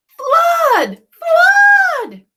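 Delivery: high, loud shout; crying out. Acted. Jack shouting flood at the birds. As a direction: shout a fake warning. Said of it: "he cried".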